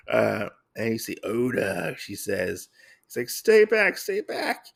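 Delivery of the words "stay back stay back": high-pitched pleading as a coward; mock cowardly panic. Oda to the attacking Lady Asano, mock panicked plea. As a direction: play-act fright, pitched way up.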